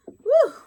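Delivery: loud, high joyful whoop; excited whoop. Real, not acted. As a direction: let out a happy woo. Excited over wreath.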